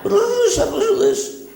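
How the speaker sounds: very high squealed host call; Alan Jones impression. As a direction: shriek it like an overexcited talkback host. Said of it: "Mister Alan Jones".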